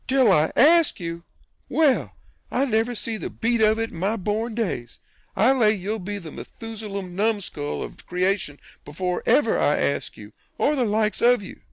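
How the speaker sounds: high, loud, slowed outrage; affronted aunt at the peak of her temper; towering indignation. Acted. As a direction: let the fury swell. Aunt Sally at full outrage.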